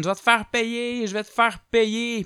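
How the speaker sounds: high, mock yelled threat; hammed-up furious threat; threatening shout. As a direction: yell the threat. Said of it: "il crie".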